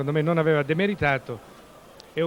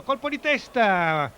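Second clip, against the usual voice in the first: high, stretched-out call of the header; excited goal-chance call; 7 semitones higher, 3 dB louder, slower than usual. Real, not acted. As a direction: shout the header as it happens.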